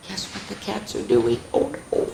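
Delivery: plain reading voice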